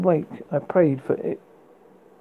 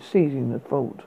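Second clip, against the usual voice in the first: ordinary delivery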